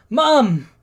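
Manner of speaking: high, loud cry for mother; acted panicked yell. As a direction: call out to mom in alarm. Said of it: "Izuku yells".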